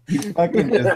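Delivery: too tickled to finish the sentence; cracking up. Real, not acted. Cracking up at exploding heads.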